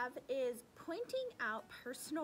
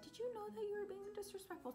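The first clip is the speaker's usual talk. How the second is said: high, quick teacher voice; teacher's confronting question; 10 semitones higher, 3 dB quieter, faster than usual. Retold or imitated. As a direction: imitate a teacher questioning a student, pitched high. Acting out teacher's gentle confronting line.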